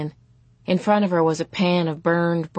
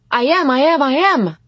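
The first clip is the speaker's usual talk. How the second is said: put-on high, loud childish insistence; yelling, pitched right up; angry shout; 9 semitones higher, 8 dB louder than usual. A narrator's reading. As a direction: shout it back at your mother. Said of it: "Phoebe shouted at her mother".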